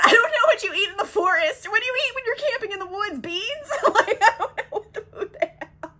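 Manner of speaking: real giggles, pitched high; helpless high-pitched amusement; cracking up. Real, not acted. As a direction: talk through rising laughter at yourself. Cracks up at own beans guess.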